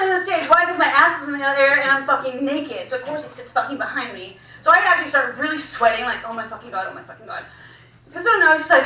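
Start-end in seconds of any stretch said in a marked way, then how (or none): 6.2-7.4 very soft, low muttered dread; hushed panic. Retold or imitated. Dancer panicking to herself on stage, hushed. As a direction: mutter it under your breath, fading to a whisper.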